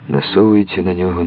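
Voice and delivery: usual speaking voice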